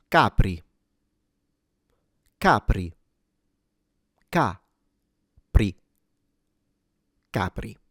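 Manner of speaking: Capri stressed on the first syllable, CA-pri, lightly trilled r; Italian Capri. Shown as a demonstration. Pronunciation teacher demonstrating Capri for learners. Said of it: "This is pronounced".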